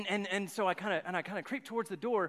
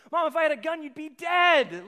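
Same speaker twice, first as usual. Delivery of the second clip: exasperated; 6 semitones higher, 11 dB louder than usual. Retold or imitated. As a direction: blurt it out to mom, disbelieving. Retold exclamation to his mom.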